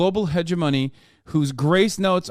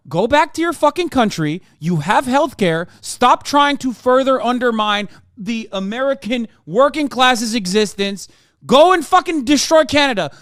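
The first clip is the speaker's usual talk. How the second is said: rising angry tirade, getting louder and higher; mock furious rant; 6 semitones higher, 4 dB louder than usual. Ranting at Canadian pundits, mock fury building to a shout.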